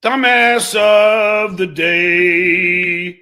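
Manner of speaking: very slow, stretched mock announcement; announcer callout. Streamer crowning the dumbass, very slow drawn-out announcement. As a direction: drag out every word like a ring announcer.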